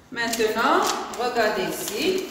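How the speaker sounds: ordinary talk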